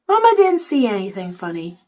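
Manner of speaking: ordinary delivery, nothing marked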